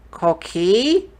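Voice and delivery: high, loud, rising question; startled hopeful kid; surprised questioning boy. Acted. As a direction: call it out hopefully, pitch climbing. Boy hears a familiar sound.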